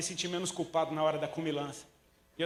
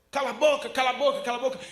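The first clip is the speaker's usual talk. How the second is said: loud, high mock shout; a hammed-up crowd yelling shut up; crowd shouting him down; 6 semitones higher, 7 dB louder than usual. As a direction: bark it at him like an angry crowd. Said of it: "mandavam ele calar a boca".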